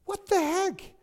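high, stunned what the heck; stunned disbelief. Retold or imitated. David seeing the army run away, high and stunned. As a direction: gape at the sight in disbelief. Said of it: "He's like".

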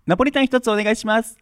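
high, fast bubbly model voice; chirpy spaghetti order, quick; Rola impression. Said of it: "スパゲッティを注文するローラ".

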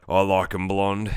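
low, slow creep voice; creepy attacker. Retold or imitated. Creepy attacker leering over his victim, low and slow. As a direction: drop it low and draw it out.

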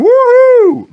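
very high, very loud audience whoop; cheering whoop. Pretend. Speaker imitates a cheering circus audience, loud high whoop. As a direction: whoop like a delighted circus crowd. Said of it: "Maybe not with that North Carolina accent".

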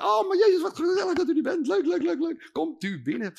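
high, delighted welcome; gushing Maria. Acted.

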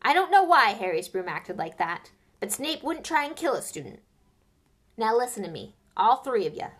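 loud stern warning; stern Hagrid. Acted. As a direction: lecture the three sternly. Narrator voices stern Hagrid.

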